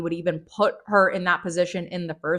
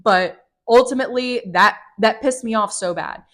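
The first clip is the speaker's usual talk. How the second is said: ordinary talk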